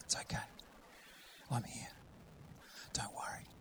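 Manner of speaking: gentle whispered comfort in the dark; very soft, calming whisper; reassuring whisper. Acted. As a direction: whisper it softly to soothe. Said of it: "presence of God with us in the darkness".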